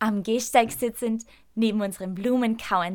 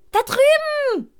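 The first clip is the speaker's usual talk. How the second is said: much higher, loud excited cry; excited shout; 9 dB louder, slower than usual. A narrator's reading. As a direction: yell it out, pointing across the street. Boy spots the lost sheep. (aufgeregt rufen, viel höher und lauter)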